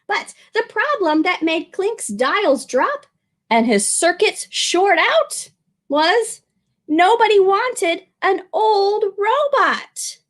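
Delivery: slow, expressive read-aloud; children's story narration; storybook narration. A narrator's reading. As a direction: read it slowly to little ones.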